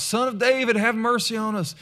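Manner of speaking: high-pitched mock plea; imitated crying out. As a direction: call out like a beggar pleading for attention. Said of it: "kept crying out".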